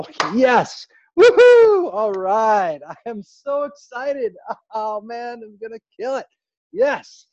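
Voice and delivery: high, loud, whooping excitement; hyped shouting then breathy giggly glee; excited demo. Shown as a demonstration. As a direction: get super worked up and cheer. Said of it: "Now you get excited".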